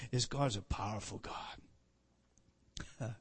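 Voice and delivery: low, slow, mock-grand voice; playful low voice. Real, not acted. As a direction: drop low and drawl it out playfully. Low slow playful voice, then laughs.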